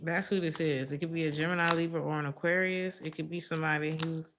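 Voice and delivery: usual speaking voice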